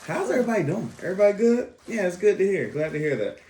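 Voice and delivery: high bright host greeting, laughing; cheery host voice. Acted. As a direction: play the upbeat host.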